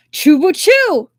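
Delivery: high, loud impatient snap; woman angrily naming the captive; angry retort. A narrator's reading. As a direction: spit out the name. Angry answer to feigned ignorance.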